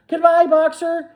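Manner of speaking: high, loud farewell call; chorused farewell. A narrator's reading. Animals calling farewell to Boxer, loud chorus. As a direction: call it out together. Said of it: "they chorused".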